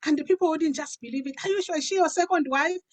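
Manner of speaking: usual speaking voice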